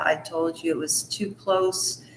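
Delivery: usual speaking voice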